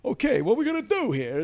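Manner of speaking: high strained nervous pig, loud; Rodney Dangerfield pig voice. Retold or imitated. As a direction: squeal it out like a tough-talking pig. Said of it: "who I modelled on Rodney Dangerfield".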